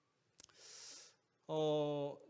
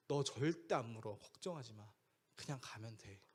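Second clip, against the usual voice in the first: low, soft fatherly comforting; calm reassurance; 6 semitones lower, 7 dB quieter than usual. Retold or imitated. Father telling son the dog will not bite, calm reassurance. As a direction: soothe the child, voice dropping softer.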